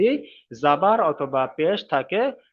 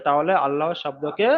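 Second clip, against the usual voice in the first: usual speaking voice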